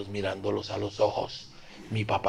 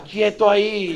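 higher, louder mock yell of a father; mock shout; 11 semitones higher, 8 dB louder, slower than usual. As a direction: bark it like a dad stopping his kid. Father shouting at fleeing son. (grito de papá, medio en broma)

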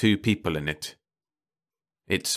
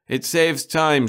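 louder, high mocking echo of "It saves time"; mocking his wife; 10 semitones higher, 6 dB louder, slower than usual. A narrator's reading. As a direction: throw "It saves time" back at her, slow and sneering. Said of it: "she says," Jack said".

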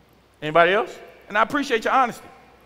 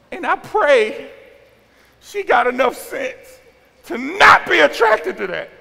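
high, loud, punched out in pieces; exasperated fatherly plea; 9 semitones higher, 8 dB louder, slower than usual. Real, not acted. Father praying about his daughter, exasperated loud outburst. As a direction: build slowly to a shouted plea.

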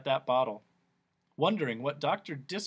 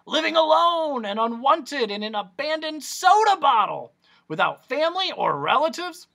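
much higher, louder showman; high, loud pompous doctor; Doctor Discord; 13 semitones higher, 8 dB louder than usual. Acted. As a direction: trumpet it like a sideshow. Doctor's story.

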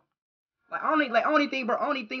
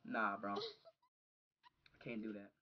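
much softer, slow murmur; hushed refusal; 12 semitones lower, 18 dB quieter, much slower than usual. Real, not acted. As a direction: barely say it, drained. Quietly refusing, shaken.

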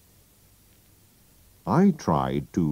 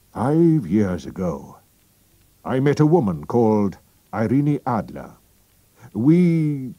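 king's voice; 6 semitones higher, 4 dB louder than usual. Acted. King telling Holmes about Irene Adler, grave. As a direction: begin the confession gravely. King begins his story.

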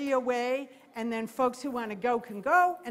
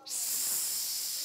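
long voiceless hiss; annoyed vulture hiss; 6 dB quieter than usual. Shown as a demonstration. Handler showing what the turkey vulture does when annoyed, long hiss. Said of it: "when the turkey vulture gets annoyed, he goes".

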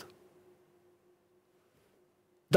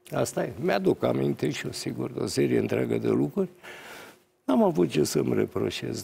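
usual speaking voice

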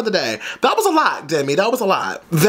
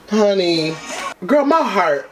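ordinary delivery

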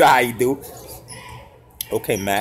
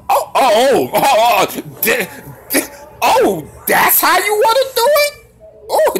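excited cries; 10 semitones higher than usual. Real, not acted. Watching a highlight, cries out at the player's move, excited. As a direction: yelp in amazement at the move. Reacting to a wild play.

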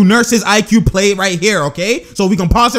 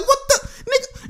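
squeaky disbelief pitched way up, trailing off soft; mock confusion; 5 dB quieter than usual. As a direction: play the stunned defender, high and incredulous. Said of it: "He's like".

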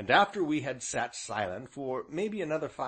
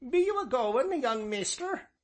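put-on much higher old villager asking; high old rustic; 13 semitones higher than usual. A narrator's reading. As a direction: ask the young guest, kindly and quaint. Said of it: "inquired the Ancient".

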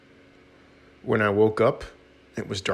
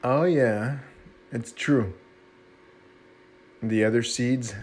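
ordinary delivery

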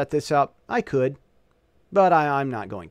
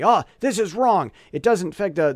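higher and louder, a hammed-up scolding computer; mock error-message yell; 5 semitones higher, 5 dB louder than usual. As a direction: play the error box yelling at you, half joking. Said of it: "Yells at me".

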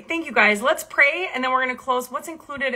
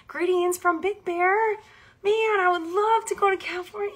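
excited; 9 semitones higher than usual. Real, not acted. Reading a viewer comment excitedly.

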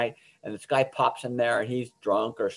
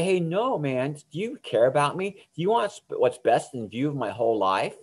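challenging refusal; 2 semitones higher than usual. Shown as a demonstration. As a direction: turn the offer back on them with a question. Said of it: "Say, say".

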